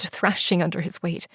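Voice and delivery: plain reading voice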